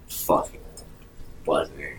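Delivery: ordinary talk, nothing marked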